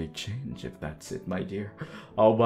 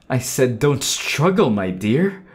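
louder, insistent, sweet menace; stern command; 3 semitones higher, 8 dB louder than usual. Acted. Lover restraining struggling darling, loud stern command. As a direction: repeat an order forcefully.

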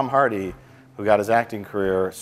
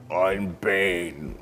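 commanding Bane voice. Retold or imitated. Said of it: "It was a commanding voice".

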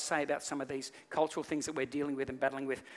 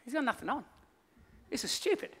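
put-on high-pitched small boy's voice; little boy; 8 semitones higher than usual. One boy stands his ground before the crowd, high child voice. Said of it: "One boy that stood his ground".

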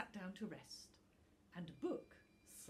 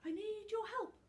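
much higher, rising frightened stammer; stuttering; 14 semitones higher, 9 dB louder, faster than usual. A narrator's reading. Little Tuft begs the snow dragon for help, stuttering. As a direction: stammer out the plea in fear. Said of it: "Little Tuft began to stutter".

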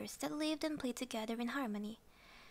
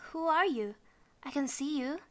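louder, higher worried question; a touch of alarm, pushed louder; 4 semitones higher, 6 dB louder than usual. A narrator's reading.

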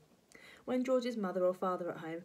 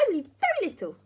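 high, loud granny retort; tetchy raised old-lady voice; crabby grandma; 11 semitones higher, 9 dB louder, faster than usual. Acted. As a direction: answer back sharply as an old woman. Grandma answering crossly.

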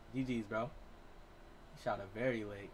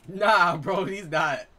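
laughing outburst; 5 semitones higher, 11 dB louder, much slower than usual. Real, not acted. Laughing at random teammates.

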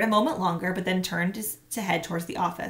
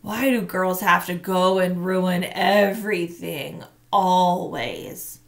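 put-on slow, louder sulky boy voice; lightly voiced grumbling boy; resentful thought; 5 dB louder, slower than usual. A narrator's reading. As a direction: drag it out like a fed-up kid. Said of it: "he thought".

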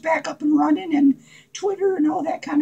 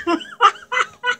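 high, giddy giggle falling away; giddy laugh; 7 semitones higher than usual. Acted. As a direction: laugh at your own joke. Giggles after sipping "blood water.

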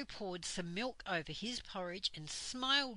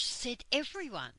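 ordinary delivery

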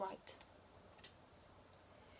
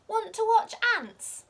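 much higher, loud excited ask; excited little friend; 11 semitones higher, 10 dB louder than usual. A narrator's reading. Rocco inviting Pom Pom to watch ants, excited question. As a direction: squeak it out with delight.

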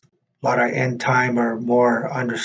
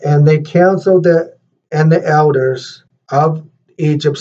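louder, measured read-aloud voice; scripture reading; 3 semitones higher, 5 dB louder than usual. Real, not acted. Reads scripture aloud to congregation.

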